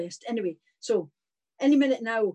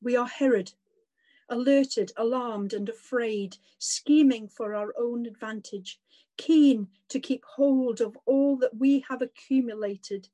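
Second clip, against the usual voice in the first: prayer. Acted. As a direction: pray it aloud, measured and reverent. Leads a spoken prayer.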